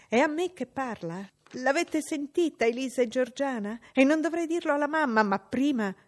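high, loud indignant tirade; outraged boy. Acted. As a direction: whip up the sisters against her. John outraged.

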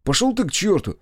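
high, indignant outburst; offended. A narrator's reading. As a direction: snap back, hurt and offended. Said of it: "Он обиделся". (обиженно, возмущённо)

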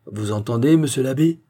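plain reading voice

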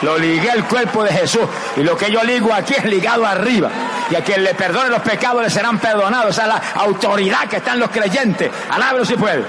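high, insistent preacher's cadence; fervent preaching. Real, not acted. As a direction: proclaim it with authority, pitched high.

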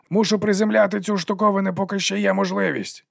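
high called-out shout; calling out. A narrator's reading. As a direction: call it over the wind. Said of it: "гукнув Лудзе".

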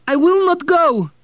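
put-on high, loud, slow boy's refusal; firm refusal. Boy refusing the Baron, high firm shout. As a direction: plant your feet and refuse him. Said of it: "said Vladek firmly".

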